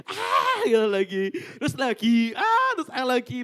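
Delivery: retelling the crowd crying out, laughing through it; mock cries. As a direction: cry out again and again, high and loud. Imitating people crying out in prayer.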